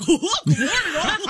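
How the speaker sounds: high, loud, jolly holler; hammed-up morning greeting. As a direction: overblown cheery self-parody bursting with laughter. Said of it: "the P O V is just like".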